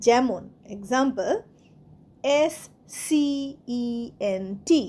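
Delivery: ordinary talk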